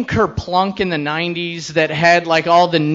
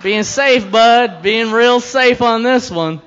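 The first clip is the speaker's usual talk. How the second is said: mocking himself; 6 semitones higher, 6 dB louder, slower than usual. Sarcastic aside to audience.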